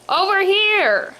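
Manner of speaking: high, drawn-out call; stretched cry for attention. A narrator's reading. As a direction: hail someone with long, lifted words. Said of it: "Mister Boring cries out".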